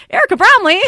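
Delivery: high, loud, delighted call of a name; a friendly guest calling out to a teacher he remembers; excited greeting. Retold or imitated. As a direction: greet her like an old friend you're thrilled to see. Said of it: "when he's like".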